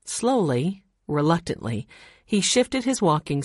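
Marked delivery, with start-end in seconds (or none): none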